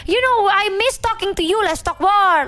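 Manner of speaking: very high, loud, theatrical mother voice; over-the-top loud mom. Retold or imitated. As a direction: crank the fake friendliness up to the ceiling. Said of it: "She goes".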